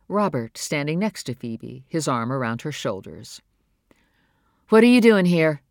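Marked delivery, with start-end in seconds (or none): 4.7-5.6 sharp question, louder and higher. Acted. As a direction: snap the question at them in alarm. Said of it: "she asked sharply".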